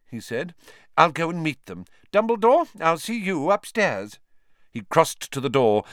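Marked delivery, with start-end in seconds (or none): 1.0-4.2 pompous minister. Acted. Fudge pompous.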